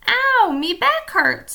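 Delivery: high, slow, loud pirate moan; pirate complaining about his sore back; cried. A narrator's reading. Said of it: "Stinky cried".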